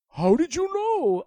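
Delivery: loud, high astonishment; amazed. A narrator's reading. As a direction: blurt it out in wonder. Dragon amazed.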